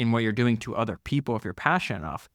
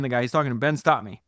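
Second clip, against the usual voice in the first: ordinary talk, nothing marked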